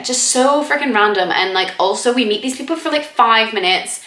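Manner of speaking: normal talking voice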